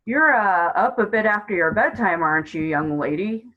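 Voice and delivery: put-on gruff officer humouring a child; loud gruff start, easing off; patronising gruff male voice; gruff policeman. A narrator's reading. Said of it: "a gruff male voice".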